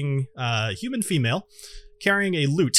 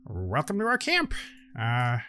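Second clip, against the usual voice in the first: gnome host; 5 semitones higher, 6 dB quieter than usual. Acted. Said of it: "he walks up to you and he says".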